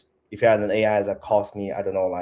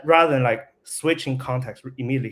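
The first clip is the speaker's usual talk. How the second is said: ordinary talk, nothing marked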